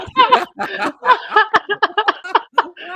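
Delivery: high loud cackling laugh; laughter. Real, not acted. Host cracking up at the guest's story, loud high laugh. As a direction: really let the laugh go.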